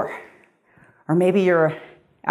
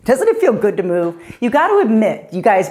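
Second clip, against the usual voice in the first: normal talking voice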